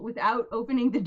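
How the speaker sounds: normal talking voice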